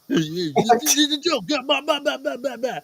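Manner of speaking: a lightly voiced goofy agent; mock funny agent. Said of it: "the funny F B I agent".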